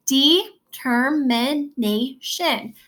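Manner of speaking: "Determination" very slowly, each syllable separate. Shown as a demonstration. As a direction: break "Determination" into five drawn-out syllables. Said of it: "How many syllables are there?".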